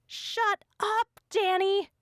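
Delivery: very high, frantic yell. A narrator's reading. Frantic friend yells at Danny to shut up. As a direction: scream her silent. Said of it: "yelled Aneth".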